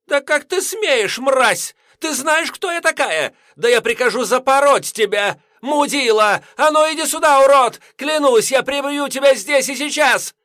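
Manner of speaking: high, loud, screeching rage; furious screaming. A narrator's reading. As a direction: scream the threats at full pitch. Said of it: "вышла на ультразвук". (яростный визг)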